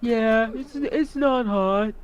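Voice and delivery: slow, dull, dim voice; slow cow-like dopey voice. Retold or imitated. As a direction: drag it out like a dazed cow. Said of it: "sat there going like a cow".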